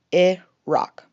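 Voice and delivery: "Iraq" said "ih-ROCK" with a short I; "Iraq" as ih-ROCK, falling; correct Iraq. Shown as a demonstration. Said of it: "The final clip has it right".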